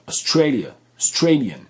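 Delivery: Australia and Australian punched on STRAY, then falling; second-syllable stress. Shown as a demonstration. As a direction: hit STRAY, weaken every other syllable of Australian. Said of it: "accentuer correctement les mots".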